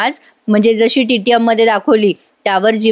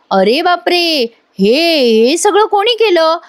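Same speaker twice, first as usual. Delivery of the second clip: mock amazement; 6 semitones higher, slower than usual. Wife feigns wonder at surprise.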